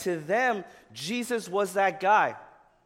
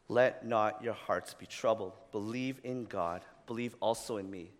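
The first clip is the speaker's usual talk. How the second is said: gentle reassurance; 6 semitones lower, 3 dB quieter than usual. Retold or imitated. Jesus comforting disciples, low and soft.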